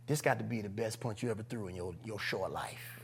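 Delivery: low, hushed self-coaching; quiet, retold resolve; inner pep talk. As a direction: psych yourself up under your breath. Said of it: "I said".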